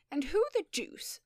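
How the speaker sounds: outraged and threatening; dangerous indignation. Acted. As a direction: square up to them with menace. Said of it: "said Mister Maturin dangerously".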